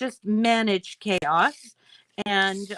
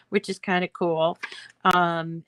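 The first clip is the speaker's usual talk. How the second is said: normal talking voice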